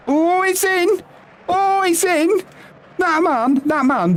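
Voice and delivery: very high-pitched, elated commentary; excited goal call. Real, not acted. Streamer celebrating his team's goal, excited high call. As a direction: cry out the goal in disbelief and delight.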